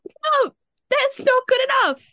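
mock teacher shrieking a refusal; shrill teacher. Teacher refuses her excuse.

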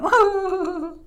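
long, high, loud "whoa"; frightened wail. Acted. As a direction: cry out like a scared boy. Boy jumps out in fright.

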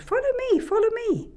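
high fairy calling, fading away. Acted. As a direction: call like a fairy flitting off. Fairy calls from the trees.

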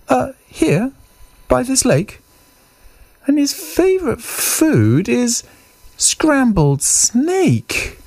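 very high mouse voice, stretching the last words; tiny mouse laying out a threat; little mouse. Acted. Mouse warns Snake what the monster eats, high mouse voice.

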